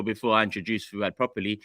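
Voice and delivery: usual speaking voice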